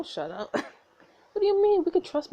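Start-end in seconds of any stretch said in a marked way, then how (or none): 1.4-2.3 high, loud indignant question; protest, surprised; indignant surprise. Acted. Protesting the brother.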